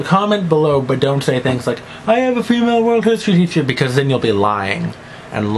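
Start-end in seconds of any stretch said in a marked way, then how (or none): 2.1-3.6 mock commenter.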